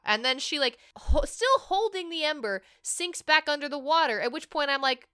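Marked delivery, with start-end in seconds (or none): none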